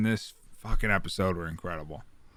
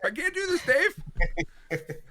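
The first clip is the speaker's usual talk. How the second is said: higher, half-laughing mock whimper; mock crying; 8 semitones higher than usual. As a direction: act overwhelmed to tears. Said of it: "I'm a fucking thirty-year-old man".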